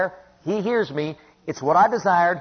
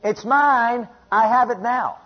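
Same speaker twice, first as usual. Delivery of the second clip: high, insistent claim with drawn-out MINE; defiant declaration; 5 semitones higher, 3 dB louder, slower than usual. Acted. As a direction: answer the devil back, pitched up. Defiant answer to the devil.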